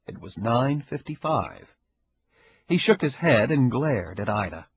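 plain reading voice

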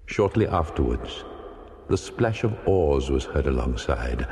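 usual speaking voice

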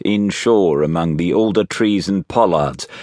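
usual speaking voice

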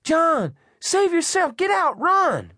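very high, frantic woman's cries; Eddie screaming. A narrator's reading. Trapped elderly woman urging man to flee, screaming. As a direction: cry out desperately in a high voice.

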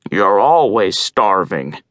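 low, slow, gruff crow; grumpy crow voice. Acted. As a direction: drag it out like a weary old bird. Grumpy old crow answers.

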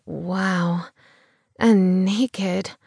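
ordinary delivery

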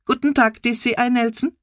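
high, smug machine voice greeting the inspector; a computer smugly welcoming its user. A narrator's reading. Computer greets Nelson at his desk, high and smug. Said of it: "äußert ein suffisantes".

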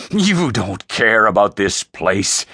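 deliberate, accusing father; disgust. Acted. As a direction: throw it back at him with scorn. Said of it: "Frey's voice dripped disgust".

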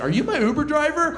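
high, bright-eyed fake innocence; mock innocent question. To the cop in his car, high mock innocent ask. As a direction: play dumb up high, laughing. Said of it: "then I'll be like".

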